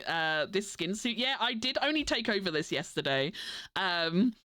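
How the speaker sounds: normal talking voice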